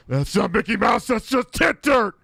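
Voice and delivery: high, loud, exasperated yell; Tourette's Guy. Retold or imitated. Dad correcting his son, shouted impression. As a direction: shout it, drawing out the ending.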